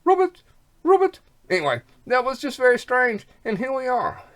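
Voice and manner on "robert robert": Robert said high and loud, close to robot; Robert with a rolled German ring, like robot; German way of saying Robert. Shown as a demonstration. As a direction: say Robert like a German grandma would. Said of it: "Robert in the German language is Robert".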